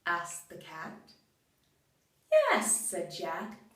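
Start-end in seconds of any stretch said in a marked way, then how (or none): 2.3-2.9 bright high-pitched yes. Acted. Jack cheerfully agreeing to the cat, high pitched. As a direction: pop the yes up high and light.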